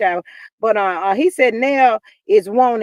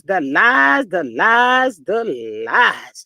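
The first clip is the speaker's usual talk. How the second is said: candy voice; 3 semitones lower, 3 dB louder, much slower than usual. Retold or imitated. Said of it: "In my candy voice".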